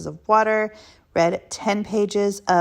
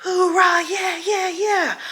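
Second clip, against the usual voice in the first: much higher mock hooray; mock cheering; 11 semitones higher, slower than usual. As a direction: cheer it up high like a hyped cheerleader. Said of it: "every day is not gonna be like".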